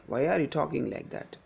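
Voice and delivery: ordinary talk, nothing marked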